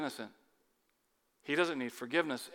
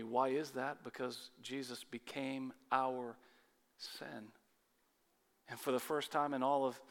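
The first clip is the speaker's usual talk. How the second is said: solemn hush; 3 semitones lower, 8 dB quieter than usual. Acted. Preacher explaining Jesus became sin, in a solemn hush. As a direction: drop to a reverent near-hush.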